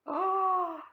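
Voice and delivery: shocked cry. Acted. Child reacts to losing toys.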